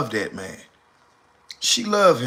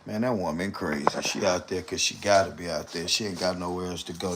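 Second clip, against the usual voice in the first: 1 mock old opinion; 6 semitones lower, 6 dB quieter than usual. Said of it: "I used to say that".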